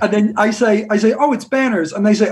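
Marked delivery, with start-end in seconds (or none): 1.2-1.9 slight American accent. Shown as a demonstration. Singer telling a fast-food server his band name, slight American accent. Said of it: "put on a slightly American accent".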